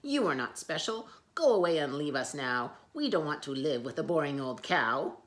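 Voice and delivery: loud, rude dismissal; rude elephants. Acted. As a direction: shoo her off loudly. Giraffes send her away.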